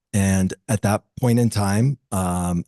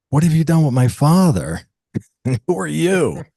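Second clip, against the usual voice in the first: high, louder mock disbelief, breaking into a laugh; hammed-up astonished son; incredulous son; 8 semitones higher, 4 dB louder than usual. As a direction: act stunned that dad has been replaced. Son stunned by father's calm.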